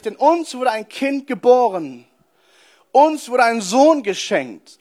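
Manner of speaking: slow, weighty Bible recitation; solemn scripture reading. Acted. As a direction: read it out like holy scripture. Reads Isaiah prophecy solemnly. (langsame, feierliche Bibellesung)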